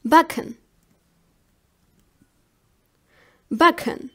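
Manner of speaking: "backen" with ck as one k sound; ck pronunciation demo. Shown as a demonstration. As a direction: model German "backen", ck like the end of cook. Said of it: "pronounced like the last letter in the word cook".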